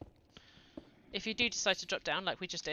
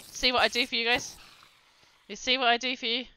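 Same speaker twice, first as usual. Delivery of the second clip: excited; 5 semitones higher, 6 dB louder than usual. Real, not acted. After nearly dying.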